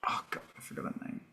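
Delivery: whispered aside to herself; whispering. Real, not acted. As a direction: drop to a whisper, muttering. Aside while forgetting a name.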